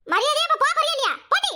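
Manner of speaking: high, angry demand to leave; angry order out. Acted. Woman ordering the nosy neighbour out of her house, angry and high. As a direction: pitch it up and chase her off.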